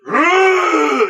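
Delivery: hammed-up monster bellow; monster grunt. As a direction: grunt like a lumbering Frankenstein. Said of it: "He's just like".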